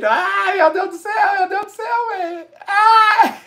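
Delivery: high crying shout of joy; overwhelmed cry. Real, not acted. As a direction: break into a tearful high wail. Subscriber count about to hit. (grito choroso e agudo de alegria)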